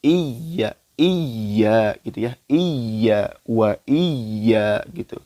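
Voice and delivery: "iya" with the ya pressed and held, slowly. Shown as a demonstration. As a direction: lean into the doubled ya of "iya". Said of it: "ditekan membacanya". ("iya" dibaca ditekan dan ditahan pelan)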